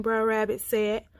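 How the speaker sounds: plain reading voice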